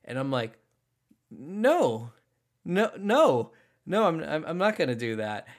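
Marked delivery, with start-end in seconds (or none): 1.3-5.5 louder, panicky no no no; nervous refusal. Retold or imitated. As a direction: back away in alarm, stammering. Refusing to go inside, alarmed.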